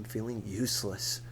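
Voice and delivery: ordinary delivery, nothing marked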